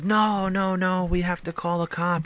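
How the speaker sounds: high-pitched mock panicked driver; freaking out. Driver insisting to the kid on calling police, freaking out. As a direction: imitate a frantic man insisting, pitch held high. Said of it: "He's freaking out".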